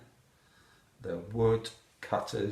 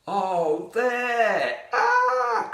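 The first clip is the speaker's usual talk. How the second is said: high, loud, rising to a shriek; frightened cry; 12 semitones higher, 7 dB louder, much slower than usual. A narrator's reading. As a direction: cry out on finding the wolf.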